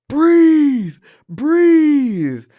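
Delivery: high, loud yelled command; corner shout. Retold or imitated. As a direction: bellow it at a fighter from the corner. Coach yelling from corner.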